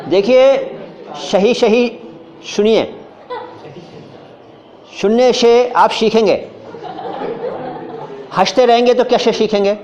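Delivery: सही, सुनिए, हंसते, सीखेंगे with SH for every S; comic over-correction, all स hushed into श, deliberate; overzealous SH. Acted. Over-eager speaker lecturing laughing students, overzealous SH. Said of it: "कोई ज़्यादा जोश में आता है".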